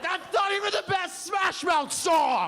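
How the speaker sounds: high, loud, drawn-out protest; outraged shout. Retold or imitated. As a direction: yell in mock outrage. Outraged reply to bad guess.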